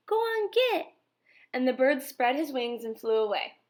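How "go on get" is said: high shout shooing birds; boy's shout. A narrator's reading. Boy shooing a crow off the rabbit, high shout. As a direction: shoo the crow away. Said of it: "flapped his arms and shouted".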